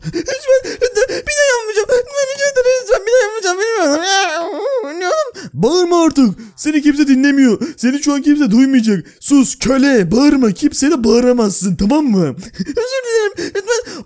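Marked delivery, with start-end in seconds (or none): 0.1-5.5 high, hammed-up crying; mock sobbing plea. Prisoner begs, mock crying. 12.6-14.0 high, hammed-up crying; mock sobbing plea. Prisoner begs.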